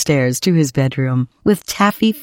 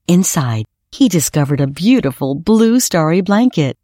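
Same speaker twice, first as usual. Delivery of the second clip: plain reading voice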